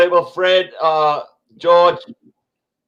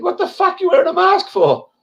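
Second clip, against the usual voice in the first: much higher mock-baffled squeal; incredulous inner voice; 13 semitones higher than usual. Streamer voicing his old thought at airport masks, mock-baffled high squeal. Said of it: "I think".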